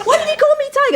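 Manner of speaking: mock child voice, high; imitating a little boy. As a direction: ask it like a confused toddler. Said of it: "He said".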